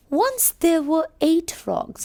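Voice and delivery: high, slow storybook opening; teacher reading the frog story to children; storytelling read. Acted.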